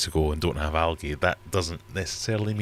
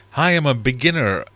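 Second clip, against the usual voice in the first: higher, louder put-on beginner voice; silly newbie voice; 7 semitones higher, 8 dB louder than usual. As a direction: act out the clueless newcomer. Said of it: "I don't know why I said it like that".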